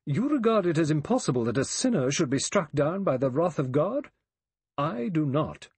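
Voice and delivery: high, loud righteous challenge. A narrator's reading. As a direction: challenge them with chin up, pitched high. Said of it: "She looked at them with shining eyes".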